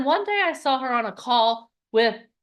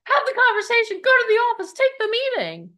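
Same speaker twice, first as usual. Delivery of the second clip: high, pressing go-do-it urging; high urgent urging; urgent coaching; 10 semitones higher, 4 dB louder than usual. Retold or imitated. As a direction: push her to go, fast and urgent. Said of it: "I'm like".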